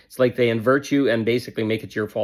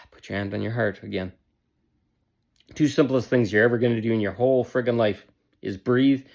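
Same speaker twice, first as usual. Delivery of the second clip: ordinary talk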